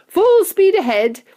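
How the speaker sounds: high, loud, stretched-out order; shouted command. A narrator's reading. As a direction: urge the crew on at full voice. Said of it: "shouted Mister Wonka, jumping up".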